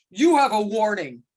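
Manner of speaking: loud, curt official warning; hammed-up stern ref. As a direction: lay down the law like a humourless referee. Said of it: "I chose that path as opposed to".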